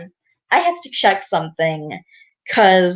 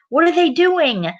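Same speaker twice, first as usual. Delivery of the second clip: high-pitched, baffled question; puzzled storybook dad; 10 semitones higher than usual. Acted. Daddy Pig hears the children playing, puzzled and high. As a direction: wonder aloud, pitched well up.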